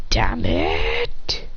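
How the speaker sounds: low, rasped curse under the voice; unvoiced, drawn-out acted frustration; frustrated curse. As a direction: curse through your frustration, stretching the end. Hero curses his broken sword.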